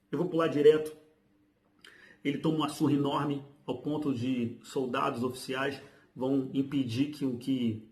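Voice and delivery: ordinary talk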